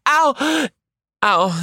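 high-pitched exaggerated "ow" with gasp; hammed-up squeal of pain; mock pain yelp. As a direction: yelp as if gripped too hard. Acting pain at hand on shoulder.

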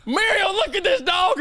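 put-on high cranky old man voice; cartoon old man. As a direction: screech it like a grumpy cartoon husband. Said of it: "Courage the Cowardly Dog. Like the dad".

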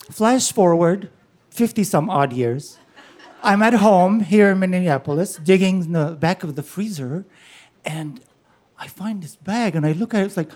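usual speaking voice

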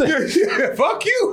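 high laughing retort; cracking up mid-insult. Acted.

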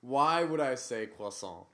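loud, slowed-down insistence; indignant. Real, not acted. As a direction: hammer the question home slowly and loudly. Repeats indignant question once more.

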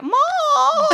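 high, laughing mock cry of Mom; mock wail. Crying to mom on phone.

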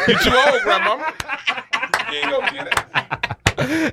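high laughing taunt; laughing tease. Acted. Teasing grandma.

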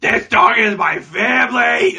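high, loud hammed-up yell; mock hysterical shout. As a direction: shout it like a melodramatic dog owner. Continuing the mocked outburst.

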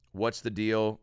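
normal talking voice

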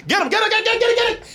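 high, laughing mock shout for help; mock frantic call. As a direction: call out in play panic as he falls. Mocking crew catching cameraman.

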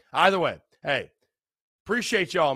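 normal talking voice

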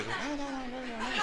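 high, soft, waving-off no; mock dismissive refusal. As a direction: brush him off like a windshield-washer kid. Imita gesto de limpiaparabrisas. (rechazo burlón, agudo y suave)

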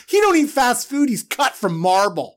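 ordinary delivery

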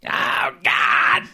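harsh shouted comic impression; shouted caricature voice; Gilbert Gottfried. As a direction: yell it in a put-on comedian's screech. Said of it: "And Gilbert Gottfried".

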